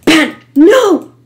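sharp gasp, then high shouted cry; real panicked outburst; panicking. Real, not acted. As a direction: yelp in sudden panic. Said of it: "I'm panicking".

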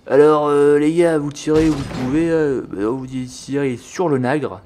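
bumbling officer giving orders; comic officer. Pretend. Officer telling his men where to fire, comic put-on voice.

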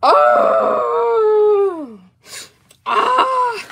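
long high "oh" of happy crying; tearful wail. Real, not acted. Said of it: "if you would see my face right now".

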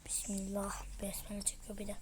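hushed teasing whisper. Real, not acted. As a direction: whisper it behind her back. Teasing sibling's prayer.

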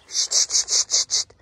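loud rapid voiceless hisses; sharp repeated hisses to get an animal's attention; luring psst. Real, not acted. (lautes, schnelles Locken mit Zischlauten)

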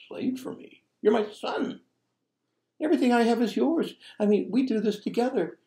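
high, quick, astonished reassurance; preacher voicing the father answering his son; father's gentle protest. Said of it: "pleads with him".

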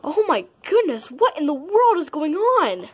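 high gasping alarm; shocked. Acted. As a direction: gasp and exclaim. Bystander sees lunch fight.

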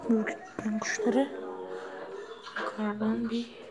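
slow whisper so the birds stay calm; hushed, breathy, careful. Real, not acted. Quiet so birds not startled. (kuşları ürkütmemek için fısıltı)

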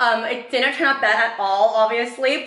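ordinary delivery

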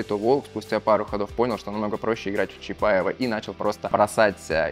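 usual speaking voice

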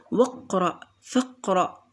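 واقرأ، فاقرأ with wa and fa joined straight to q; no glottal stop before the q in واقرأ، فاقرأ; hamza dropped. Shown as a demonstration. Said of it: "همزة وصل لأنني لم أق-، لم ألفظها".

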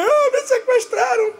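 very high, hammed-up tearful wail; fake-sob it in a squeal; mock crying. Sister crying about her made-up kidnapping, mock wailing. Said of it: "E ela chorando também".

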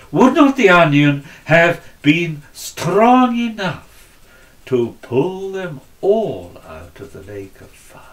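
ordinary delivery

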